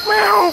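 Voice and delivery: squeaky high meow imitation; high cat meow. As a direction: mew like a cat. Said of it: "when I said hello".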